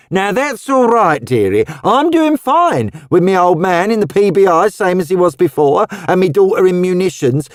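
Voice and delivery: put-on high, lively Cockney old woman; Cockney charwoman. A narrator's reading. Missus Tubbs startled by Roseanne's outfit, high lively Cockney.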